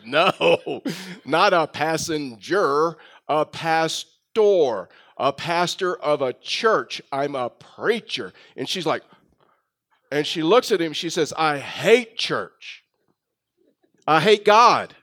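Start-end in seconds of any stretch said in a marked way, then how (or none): 0.0-8.3 drawn-out, over-enunciated correction; acting out a patient clarification, very slowly. Correcting a mishearing of pastor. 11.4-12.8 slow, drawn-out declaration of dislike; imitating a hostile stranger; acted disdain. Acting woman's hostility to church. 14.1-14.9 loud, raised-pitch declaration; imitating a hostile stranger, forcefully; acted hostile outburst. Acting woman's escalating hostility.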